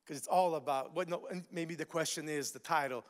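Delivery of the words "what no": ordinary delivery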